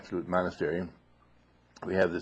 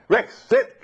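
much higher, louder command; shouting command; 14 semitones higher, 11 dB louder than usual. Retold or imitated. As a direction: bark the order. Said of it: "Whereas the shouting".

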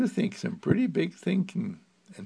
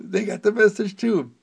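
louder, higher repeated exclamation, fed up; exasperated repeat; 8 semitones higher, 6 dB louder than usual. Retold or imitated. Telling someone who repeats it that they already know, exasperated and loud. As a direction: say it again, more insistent. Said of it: "and I say".

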